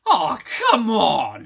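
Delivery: disappointed whine. Retold or imitated. Detmer denied the mission, loud slow whine. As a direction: whine like a kid denied a treat. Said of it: "She has this look on her face like".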